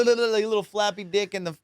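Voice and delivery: usual speaking voice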